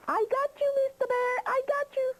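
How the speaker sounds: very high triumphant crowing; gloating witch. Acted. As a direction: crow it in a high witchy screech. Witch gloats over trapped bear.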